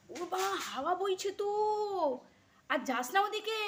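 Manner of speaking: high, loud warning call. Real, not acted. Telling Kutus not to go out in the wind, high loud call. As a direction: call him back from over there.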